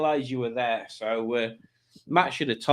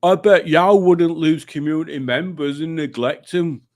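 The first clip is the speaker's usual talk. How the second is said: put-on loud comic regional accent; hammed-up, struggling accent; Tommy's put-on accent; 2 semitones higher, 6 dB louder than usual. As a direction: push through it in an imitation accent. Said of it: "reading things in Tommy's accent".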